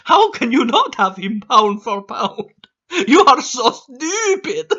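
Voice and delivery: mock the troll like a smug boy genius; troll as Dexter. Vlogger reading troll comments in a high mocking cartoon voice. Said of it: "how I imagine them in my mind".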